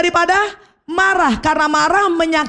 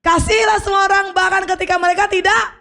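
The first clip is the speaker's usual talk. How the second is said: shouted pledge; 6 semitones higher than usual. Shown as a demonstration. Preacher leading the congregation in a shouted pledge. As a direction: lead the crowd in a ringing vow. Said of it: "Tiga, dua, satu".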